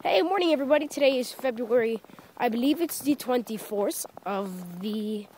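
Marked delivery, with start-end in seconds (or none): none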